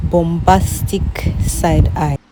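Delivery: loud, punchy delivery; loud punchline. Real, not acted. Mocking dad's self-pity, loud meme punchline. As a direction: belt out the meme phrase.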